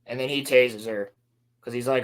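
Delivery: ordinary delivery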